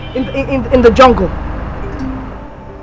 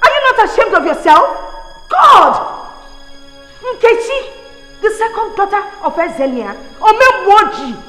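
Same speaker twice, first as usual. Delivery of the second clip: furious tirade; 5 semitones higher than usual. Acted. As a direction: lash out at her brother. Sister berates brother loudly.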